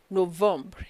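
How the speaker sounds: normal talking voice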